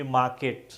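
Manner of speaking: मार्केट with the R dropped, "maa-ket"; silent R. Shown as a demonstration. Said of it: "तो इसको काट देंगे". (मार्केट में आर चुप, "माकेट")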